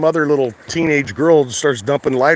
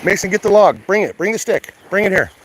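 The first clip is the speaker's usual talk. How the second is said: calling the dog; 7 semitones higher than usual. Real, not acted. Owner calling his dog Mason to bring the stick, higher call. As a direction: call him over across the beach.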